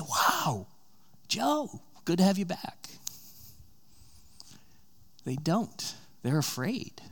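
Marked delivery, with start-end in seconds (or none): 0.0-2.9 glad welcome. Pretend. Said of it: "why don't they say".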